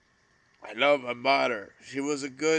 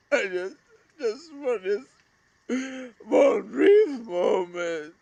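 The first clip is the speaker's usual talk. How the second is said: begging a lost brother for one more moment, sobbing; crying out; 9 semitones higher, 4 dB louder than usual. Real, not acted. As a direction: cry out the wish through tears. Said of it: "this video became so emotional".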